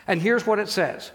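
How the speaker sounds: ordinary delivery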